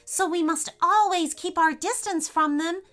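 high, careful teacherly voice; fairy lecturing her friends; instructing. Acted. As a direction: explain it like a guide. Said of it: "instructed Freya".

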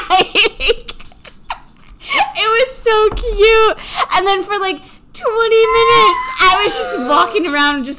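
high, loud laughing, near crying; laughing till tears. Real, not acted. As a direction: giggle through the words, wiping tears. Laughing till crying over the dog.

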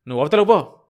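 loud angry shout; husband shouting at his wife. Acted. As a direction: yell at her to get away. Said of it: "భర్త అరుస్తున్నాడు".

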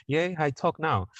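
high laugh then slow playful boast; giggly mock-proud finish; laughing triumph. Real, not acted. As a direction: celebrate with a laugh.

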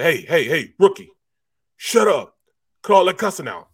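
imitating a stern scold. Veteran linebacker barking at a cussing rookie, loud and stern. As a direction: shut the rookie up from across the huddle.

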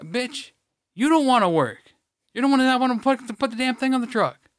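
high-pitched, heated comeback; angry yelled retort. Retold or imitated. Retold worker arguing back over the truck, yelling. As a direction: fire the accusation back at them.

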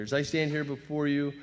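ordinary talk, nothing marked

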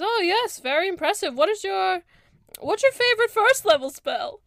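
high, loud elf voice; Mince the cleric. Acted. Elf cleric asking about a favorite spell, high and keen. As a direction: quiz a fellow cleric in a high voice. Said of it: "he says".

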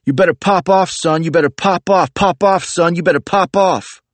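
high, loud street-tough taunt, over and over. Acted. Bro charging up the street yelling at narrator, high loud taunt. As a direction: shout the challenge like a bro itching to fight.

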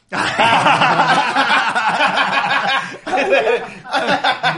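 a long, high, loud fit of real laughter; laughing fit. Real, not acted. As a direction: lose it completely and keep laughing.

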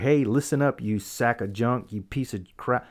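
normal talking voice

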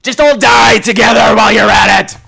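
loud comic fury; ranting shout. Acted. Fed-up viewer ending a rant at the cast, comic furious shout.

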